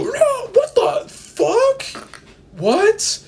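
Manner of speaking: very high, loud stunned shrieks; shocked disbelief. Pretend. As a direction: squeal in total disbelief at magic.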